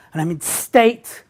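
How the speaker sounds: ordinary delivery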